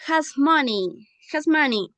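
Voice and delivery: "has money" said slowly; slow dictation. Shown as a demonstration. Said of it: "Marco has a". (dictado lento de "has money")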